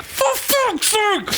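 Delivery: very high, drawn-out mock exasperated yell; exasperated outburst. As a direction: let out a slow, high wail of despair.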